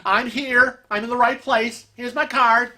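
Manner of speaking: proud as punch, parodied; mock proud. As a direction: imitate someone smugly sure they are right. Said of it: "she's like all proud".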